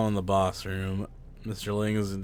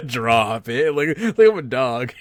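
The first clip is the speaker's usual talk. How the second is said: mock indignant; 2 semitones higher, 9 dB louder than usual. Mocking the teammates' command.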